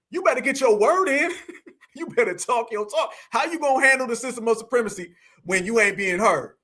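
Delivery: ordinary delivery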